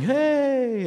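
high, drawn-out "hey"; imitated happy holler; carefree kid calling. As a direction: call out high like a kid floating past. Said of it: "young kids going".

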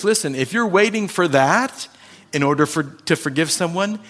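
usual speaking voice

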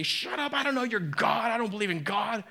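high-pitched mock outburst, half-hearted; mock yelling. Pastor imagines cussing at a churchgoer, mock yelling high. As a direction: play the pastor losing it, mock angry. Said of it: "start cussing and yelling".

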